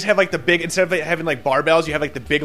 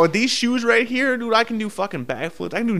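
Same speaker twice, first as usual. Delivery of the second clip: boastful; 7 semitones higher than usual. Pretend. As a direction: brag like a cocky kid. Bragging about magic shoes.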